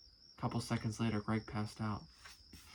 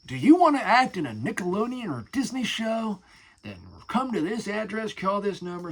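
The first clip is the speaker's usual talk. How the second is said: higher, louder hyped announcer; radio ad voice; 8 semitones higher, 8 dB louder than usual. Retold or imitated. Imitating the radio ad, hyped announcer voice. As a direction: sell it like a cheesy radio spot. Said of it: "the radio stations when they would say".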